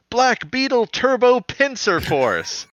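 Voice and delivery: high, slow hero roll call. Retold or imitated. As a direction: shout the transformation call high.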